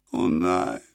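low, quiet despairing moan, "nein" sinking. A narrator's reading. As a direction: groan the words low. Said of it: "stöhnte das Mädchen". (leises, tiefes Stöhnen auf "nein")

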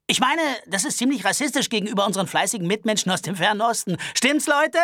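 high-pitched nervous Albert voice. Acted. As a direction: complain fussily and fast. Albert appeals to the crowd.

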